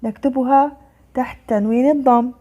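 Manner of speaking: usual speaking voice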